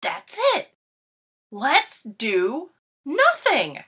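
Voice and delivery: eureka, drawn out with delight; excited idea. Acted. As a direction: shout out the big discovery. Sudden bright idea.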